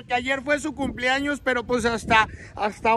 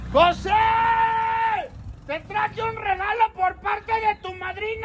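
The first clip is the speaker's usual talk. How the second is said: calling out; 5 semitones higher than usual. Real, not acted. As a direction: holler it to someone out of sight. Calling out to the hidden birthday boy.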